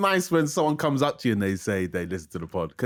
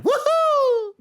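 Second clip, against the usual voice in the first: excited cheer; 4 dB louder than usual. Real, not acted. Birthday cheer on podcast.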